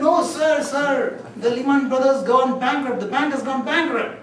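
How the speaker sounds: high, loud insistent report; frantic high-pitched insisting; urgent. Retold or imitated. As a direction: press the bad news hard. Accountant insists on bankruptcy.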